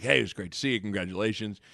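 low, soft imagined coach; Coach Altman. Retold or imitated. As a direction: imitate a coach quietly congratulating. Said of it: "He'd be like".

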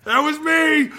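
high, loud boastful yell; proud coach. Retold or imitated. Coach Harbaugh boasting to the team after his kickoff film, loud and high. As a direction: crow it to the whole room. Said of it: "He's like".

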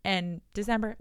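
ordinary talk, nothing marked

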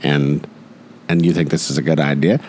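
low, deliberate questioning with long pauses; lightly voiced wise adviser, incredulous; Morgan Freeman. Adviser questioning a plan to blackmail Mister Wayne's kind, low Morgan Freeman impression. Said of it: "it's always that voice".

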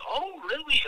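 high, impressed "Oh, really?"; mock surprise. Escort hearing he's from Wisconsin, surprised high. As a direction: play her bright surprise.

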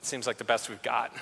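resigned king. Acted. Saul voiced, resigned.

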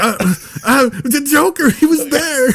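high-pitched, protesting mock voice; imitated arguing. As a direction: imitate a friend insisting, voice climbing sharply. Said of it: "arguing with everybody".

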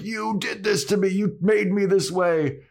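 high, wailing troll accusation. Retold or imitated. Troll blaming Anya for his state, high aggrieved voice. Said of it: "the troll is like".

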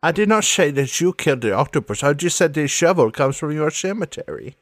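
put-on quick accented villainess; Sans Serif, defensive. Denying she accused him, quick accented protest. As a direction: protest briskly, in character accent.

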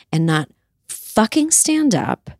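usual speaking voice

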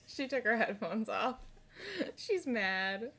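ordinary talk, nothing marked

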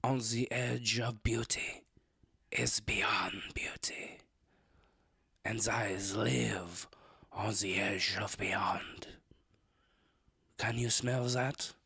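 slow breathy whispered perfume commercial; sultry perfume ad. Pretend. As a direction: whisper it like a cheesy fragrance ad. Mock perfume commercial break.

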